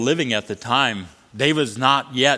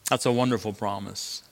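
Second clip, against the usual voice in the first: ordinary talk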